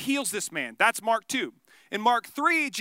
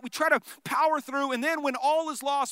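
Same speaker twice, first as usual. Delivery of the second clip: ordinary talk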